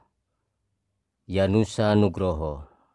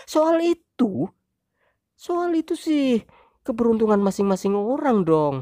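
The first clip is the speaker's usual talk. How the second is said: high, loud wife voice, scornful; 4 dB louder than usual. Acted. Wife scorning husband's point, high loud voice. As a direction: sneer it off as the wife. Wife dismissive final retort.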